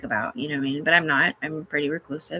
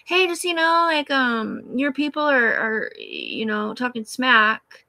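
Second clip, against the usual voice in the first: higher, sweetly hesitant voice; mock polite caller; 5 semitones higher than usual. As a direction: play it small and overly nice on the phone. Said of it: "I'm like".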